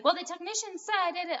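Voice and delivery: a lightly hammed-up whiny excuse; mock quoting to doctor. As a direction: parrot the technician like a silly excuse. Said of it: "call my endocrinologist tonight and be like".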